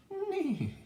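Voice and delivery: very high, loud whinny; piercing storybook whinny; horse neigh. A narrator's reading. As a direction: imitate a horse with a squealed neigh. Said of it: "said a horse".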